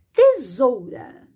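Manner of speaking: "Tesoura" slowly, a clear z in the middle; slow sounding out. Shown as a demonstration. Teacher sounding out "Tesoura" slowly for spelling. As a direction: draw out "Tesoura" syllable by syllable. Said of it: "som de zo".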